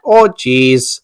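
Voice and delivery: lightly put-on Wisconsin accent. Friend echoing a drinking-game card about slipping into your accent, Wisconsin accent. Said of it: "slip into your accent".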